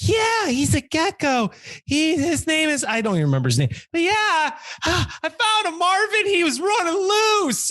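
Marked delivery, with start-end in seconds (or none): 0.1-2.8 lightly voiced eager woman; excited lady. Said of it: "she's like". 3.9-7.7 high proud woman voice; lightly voiced proud lady; excited lady. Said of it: "she's like".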